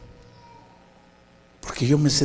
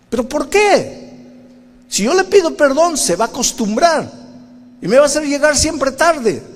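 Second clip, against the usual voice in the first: a high, complaining plea; whiny protest; 13 semitones higher, 3 dB louder than usual. Acted. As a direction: whine like a stubborn child. Stubborn father argues with Spirit.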